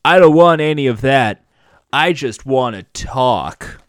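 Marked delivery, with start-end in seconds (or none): none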